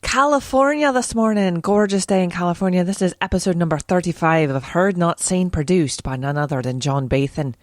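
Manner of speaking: put-on Irish accent. Podcaster opens her show for a twist, in a put-on Irish accent. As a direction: play the podcast host with an Irish lilt. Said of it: "a bit of an Irish accent".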